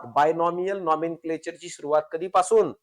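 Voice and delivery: usual speaking voice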